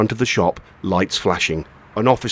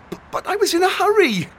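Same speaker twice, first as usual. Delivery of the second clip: put-on higher boy's voice, stammering nervously; spluttering boy. A narrator's reading. Boy caught by a shopkeeper, spluttering nervously. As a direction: stumble over the first word, caught out. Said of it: "he spluttered".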